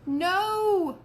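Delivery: put-on high, loud child's shout. A narrator's reading. Fudge shouting no to the punishment. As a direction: yell it out in protest. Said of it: "Fudge shouted".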